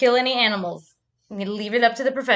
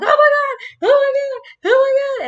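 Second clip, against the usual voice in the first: very high, loud panicked squeal; retold freaking out; 10 dB louder than usual. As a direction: re-enact a frantic freak-out. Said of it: "I started freaking out".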